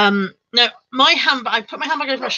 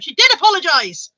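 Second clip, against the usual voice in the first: high-pitched indignant defence; raised, emphatic protest, slowing at the end; piping up; 8 semitones higher, 4 dB louder, slower than usual. Retold or imitated. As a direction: re-enact butting in to defend someone, insistently. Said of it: "this is the point I piped up".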